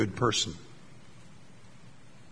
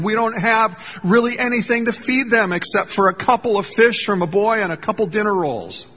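flabbergasted; 7 semitones higher than usual. Retold or imitated.

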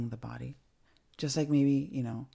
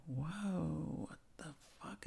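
a hushed, dazed whisper on waking; stunned whisper; 12 dB quieter, much slower than usual. Retold or imitated. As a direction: breathe it out in disbelief, half awake. Waking groggy after anesthesia.

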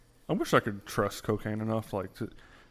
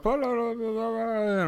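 put-on kid babble; 12 semitones higher, slower than usual. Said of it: "I'm like".

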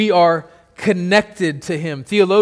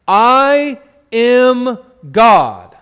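very slow, louder and higher proclamation; voice of God; 4 semitones higher, 6 dB louder, much slower than usual. Acted. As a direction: stretch each word out like God announcing himself. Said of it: "That's God".

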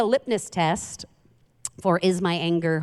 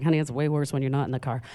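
low, soft, fast deadpan aside; dry quip; 6 semitones lower, 3 dB quieter, faster than usual. Retold or imitated. Mother quipping back to her daughter, low deadpan. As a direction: toss off a dry low comeback. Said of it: "But then I was like".

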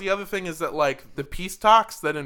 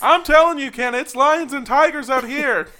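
high, loud mock field reporter; field reporter bit; 10 semitones higher, 8 dB louder than usual. Playing along with the DJ joke, a loud high reporter. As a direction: call it in like a frantic traffic reporter.

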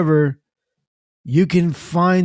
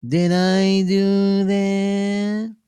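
high, slow nasal nerd voice; nerdy catchphrase voice; 6 semitones higher, much slower than usual. Pretend. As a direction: drag it out like a sitcom nerd. Catchphrase in put-on voice.

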